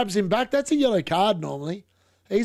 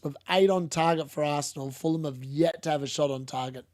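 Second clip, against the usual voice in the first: normal talking voice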